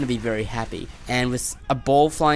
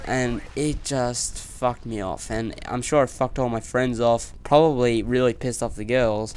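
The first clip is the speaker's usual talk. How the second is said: normal talking voice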